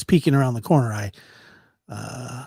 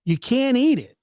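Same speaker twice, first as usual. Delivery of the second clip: insisting; 10 semitones higher, 3 dB louder than usual. Retold or imitated. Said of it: "I said".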